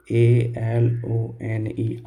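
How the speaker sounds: letters spelled one by one, very slowly; spelling the word for students. Shown as a demonstration. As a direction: dictate each letter slowly. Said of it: "जैसे मैंने लिखा alone".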